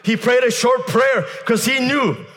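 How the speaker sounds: high, shouted revival proclamation; fervent, triumphant preacher's shout; full-force preaching. Real, not acted.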